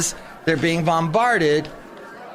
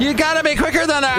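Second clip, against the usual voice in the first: mock taunt; 12 semitones higher, faster than usual. Host mimics the taunt higher.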